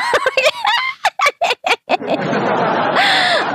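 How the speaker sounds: high giggle that runs on; long squealing giggle; long high laugh. Real, not acted. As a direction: keep cackling up high. Laughing at rival.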